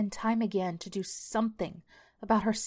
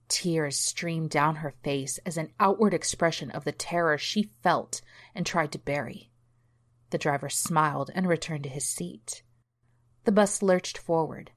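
ordinary delivery, nothing marked

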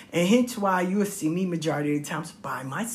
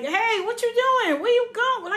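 calling after someone. Retold or imitated. Kids to mother walking off without the shopping, bewildered calling. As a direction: call after her in alarmed confusion.

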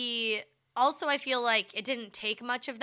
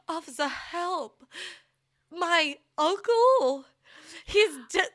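slow, high, drawn-out cry for help; dramatic plea; 7 semitones higher, slower than usual. Shown as a demonstration. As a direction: melodramatic old-movie plea. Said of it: "No, it's like".

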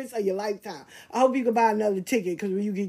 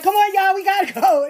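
loud, higher call to her group; 9 semitones higher, 8 dB louder than usual. Retold or imitated. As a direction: round everyone up to leave, loudly. Calling her group to leave.

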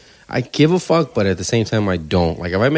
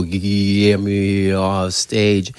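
drawn-out, trembling mock plea; shaking voice; much slower than usual. Bombing comic pleading to the host, shaking voice. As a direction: imitate a frozen comic, painfully slow and strained. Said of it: "Then my voice starts shaking".

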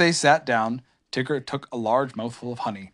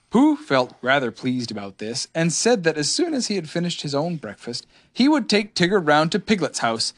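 plain reading voice

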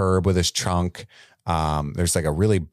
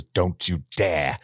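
mock Aunt Marge threat; 3 semitones lower, much slower than usual. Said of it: "looks down comedically and goes".

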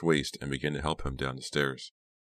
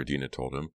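usual speaking voice

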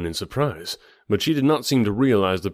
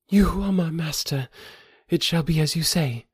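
higher voice, a touch of sadness; simple sad reply; 8 semitones higher than usual. A narrator's reading. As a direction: Thuvia accepting, a hint of sadness. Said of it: "a note of sadness in her voice".

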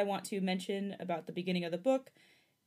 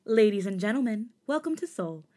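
soft-spoken flight attendant announcement; polite cabin announcement voice; airline hostess; 3 semitones higher, 7 dB louder than usual. Pretend. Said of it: "to soft-spoken airline hostess".

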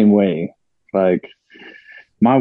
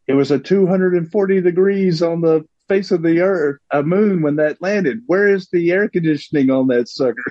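mock indignant quip; 7 semitones higher than usual. Mock-quoting at the lunar lander.

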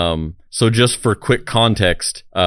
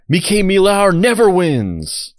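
high, slow, loud yell; hammed-up furious shout, much higher; mock yell; 11 semitones higher, 4 dB louder, slower than usual. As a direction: shout it as the final escalation. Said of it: "The final one should have been".

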